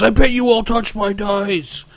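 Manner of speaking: high, drawn-out accusing whine; mock tantrum, pitched high; imitated angry screaming. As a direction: mimic a player's paranoid rant, slowly. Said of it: "started screaming at everyone".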